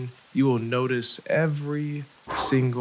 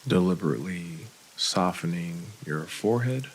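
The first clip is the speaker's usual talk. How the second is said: guided meditation. Real, not acted. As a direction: lead the breathing unhurriedly, with long gaps.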